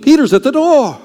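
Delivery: high and loud, blurting "Peter's at the door"; excited announcement. Pretend. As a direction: burst in breathless with "Peter's at the door". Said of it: "and says, not a long speech".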